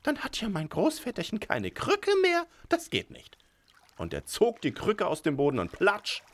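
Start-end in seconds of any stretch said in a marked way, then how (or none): 0.0-3.4 high, loud imp protesting; horrified little devil. A narrator's reading. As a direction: squeal it like an upset imp. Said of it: "ganz entsetzt".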